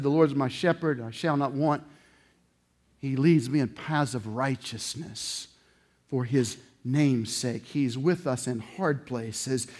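tender scripture recitation; quiet psalm reading. Acted. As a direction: recite it gently like prayer. Quoting psalm softly.

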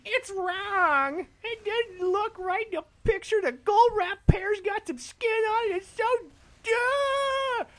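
hammed-up angry customer yelling; mock screaming customer. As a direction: shriek it like an outraged caller. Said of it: "are screaming in your ear".

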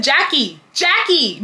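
put-on high, childish call to a friend; childish mock calling. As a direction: call her name like a petulant kid. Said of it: "when I get mad, I am childish".

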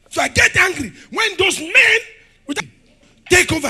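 loud, high, shouting preacher; fiery preaching. Acted. Preacher rouses church about dominion, shouting loud and high. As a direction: hammer each line at the congregation.

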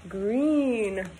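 usual speaking voice

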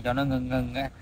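usual speaking voice

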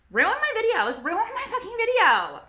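high, loud mock-indignant complaint; high-pitched ranting, playing up the grievance; mock outrage. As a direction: wail it out in exaggerated indignation. Mock outrage at ruined video.

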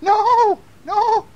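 very high, loud protest; high-pitched storybook wail; old lady crying out. A narrator's reading. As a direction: cry out in alarm as an old lady. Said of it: "cried the old lady".

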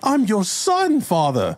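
high, drawn-out plea; pleading. Acted. Son pleads with Father, high and drawn out. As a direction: stretch out a high plea, dropping on the last word. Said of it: "He tries to plead with Father".

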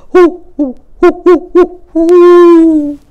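high, loud owl echo from the trees; far-off owl answering the call; answering owl hoots. A narrator's reading. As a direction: hoot back as the distant answering owl. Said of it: "an echo came threading its way".

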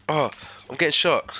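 alarmed shout. Real, not acted. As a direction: yell it while getting shot. Under fire in game.

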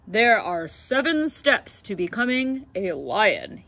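lightly voiced proud lion teacher; a hint of a grand lion coach. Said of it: "he said".

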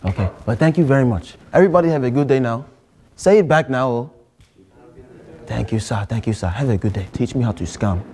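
put-on lightly held Nigerian accent, earnest student; a Nigerian student pleading with the teacher. To a geography teacher, a mild Nigerian accent. Persona saying goodbye and pitching scam.

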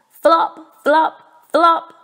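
loud, high bouncy flop flop flop; flopping sounds. A narrator's reading. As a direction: bounce each flop like a clumsy frog.

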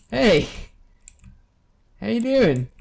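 high, loud sing-song hello; mock friendly greeting. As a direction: greet the monster like an old pal. Greeting a creature mockingly.

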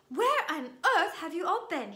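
high, loud indignant question; astonished scolding. A narrator's reading. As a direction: demand it in shocked disapproval. Portrait scolds late, sweaty students.

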